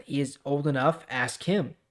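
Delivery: usual speaking voice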